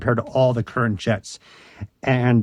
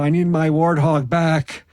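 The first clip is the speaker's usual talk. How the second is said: desperate plea; 4 semitones higher than usual. Retold or imitated. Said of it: "waking up at three in the morning and go".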